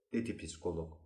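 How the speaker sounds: ordinary delivery, nothing marked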